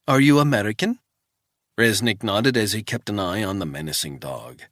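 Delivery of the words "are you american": put-on old Swiss woman, higher and louder, thick Swiss accent; wary old housekeeper in a thick Swiss accent; old housekeeper questioning a stranger, thick Swiss accent. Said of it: "in a thick Swiss accent".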